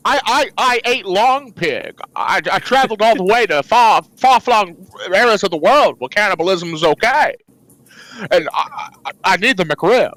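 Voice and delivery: stammering, desperate addict in character; Jimmy Stewart. Acted. Jimmy confessing a McRib addiction, stammering desperately. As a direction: stutter through a hammed-up confession. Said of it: "I'm Jimmy Stewart and I'm jonesing".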